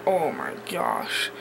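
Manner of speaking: whispered oh my gosh. Retold or imitated. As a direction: breathe it out, unvoiced. Cringing at own old vlogs.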